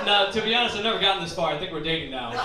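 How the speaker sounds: ordinary talk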